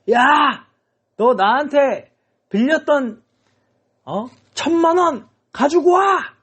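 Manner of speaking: high, drawn-out acted shout; angry demand. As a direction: play a creditor demanding his money back. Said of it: "막 이렇게 지금 삿대질을 하고 있는".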